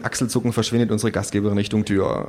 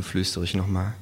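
plain reading voice

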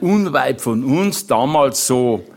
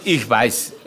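ordinary talk

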